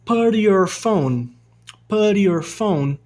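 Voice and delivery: "put your" linked as "puddy-your", slowly; T like a soft R. Shown as a demonstration. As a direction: flap the T in put into your, American style. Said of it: "esse T ele vai ter meio que um sonzinho de R".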